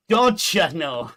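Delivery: put-on laughing Minnesotan accent. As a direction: ham up the Minnesota drawl through a laugh. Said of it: "you drag the O's".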